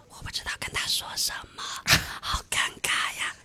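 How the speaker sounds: unvoiced whisper with a nervous giggle; imitating a flustered friend whispering; embarrassed whisper. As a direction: hush it like a secret you're ashamed of. Friend whispers her panic.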